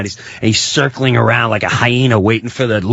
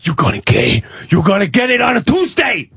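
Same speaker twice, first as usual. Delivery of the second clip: mock threat rising to a high shout; angry threat; 7 semitones higher than usual. Pizza man threatens his neighbor, voice rising to angry shout. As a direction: imitate a furious man, climbing in pitch.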